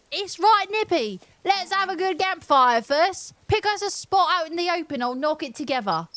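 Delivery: high-pitched Cockney character voice; Cockney accent. Acted. Cockney villager advising on a campfire, high Cockney accent. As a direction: play a chirpy Cockney, pitched well up. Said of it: "He's got a Cockney accent".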